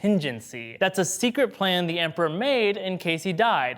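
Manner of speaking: ordinary delivery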